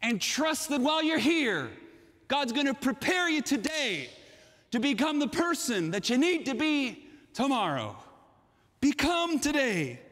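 impassioned closing call; fervent preaching. Real, not acted. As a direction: drive each charge home to the congregation.

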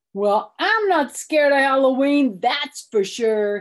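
put-on loud boasting boy; talking loud. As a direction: declare it loudly for all to hear. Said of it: "he talked real loud".

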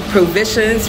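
ordinary talk

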